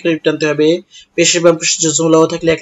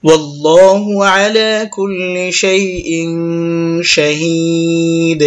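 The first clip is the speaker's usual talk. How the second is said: very slow tajweed chant, long final syllable; Quran recitation; much slower than usual. Shown as a demonstration. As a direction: recite with held hums and stretched vowels. Recites the verse just spelled out.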